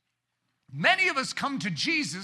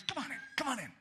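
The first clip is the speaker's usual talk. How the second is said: much softer, coaxing call; gentle invitation; 5 semitones higher, 8 dB quieter, faster than usual. Retold or imitated. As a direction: beckon someone in softly. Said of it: "He goes".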